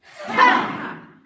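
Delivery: high loud chop yell with a laugh; yell into laughter. Real, not acted. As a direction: belt it out, laughing. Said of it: "Let's do one more".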